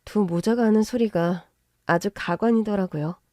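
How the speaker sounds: plain reading voice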